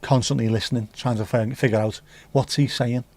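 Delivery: ordinary talk, nothing marked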